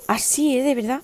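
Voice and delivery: normal talking voice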